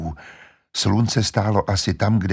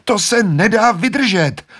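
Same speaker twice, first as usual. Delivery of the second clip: very high, louder anguished cry; pained cry; 12 semitones higher, 4 dB louder than usual. Acted. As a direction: cry it can't be borne. Lucy in pain. (Lucie bolestně naříká)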